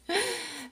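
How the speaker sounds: short, high, soft laugh; embarrassed laugh. Real, not acted. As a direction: laugh lightly at your own slip. Laughs after knocking the video. (petit rire gêné)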